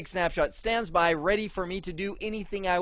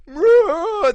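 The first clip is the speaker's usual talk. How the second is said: loud, high-pitched mock wail; imitated melting groan; 12 dB louder than usual. Retelling people melting on the transporter pad, mock agonized wail. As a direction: wail like someone melting, hammed up.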